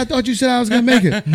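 teasing the medic through laughter; laughing. Real, not acted. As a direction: crack up while delivering the punchline. Laughing while retelling medic line.

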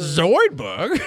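Doctor Zoidberg. Acted. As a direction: put on a questioning cartoon impression. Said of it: "like a naked Doctor Zoidberg".

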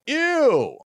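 high, loud disgusted "Ew!" from the balcony; retold disgusted shout. Lady in the far balcony heckling, shrill disgusted shout. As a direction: yelp it out like a grossed-out fan. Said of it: "this lady in the far back goes".

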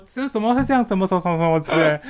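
high, alarmed aunt voice; aunt finding the kid stuck in the toilet, high and alarmed. Retold or imitated. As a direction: mimic the aunt's shocked fuss. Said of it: "然后我阿姨就说什么".

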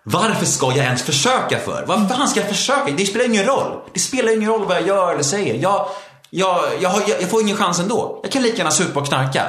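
high-pitched exasperated protest; worked up and despairing, pitched well up; angry, resigned. Retold or imitated. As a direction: relive the anger of giving up. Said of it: "jag blev så arg och uppgiven".

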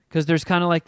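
ordinary talk

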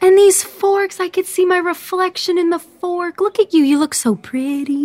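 high, gushing delight; excited wonder. Acted. Orphan marveling at restaurant cutlery, excited wonder. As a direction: gush over it, pitched well up.

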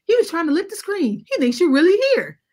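put-on excited kids. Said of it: "And they're like".

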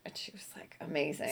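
hushed, breath-only praise; awed whisper. Real, not acted.

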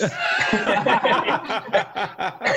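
high, breathless burst of laughter; high laughing fit. Real, not acted. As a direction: break up laughing at the memory. Laughing at his own story.

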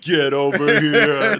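slow, drawn-out mock command; imitating a growl. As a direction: drag it out like a fighting game move. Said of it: "you're like".

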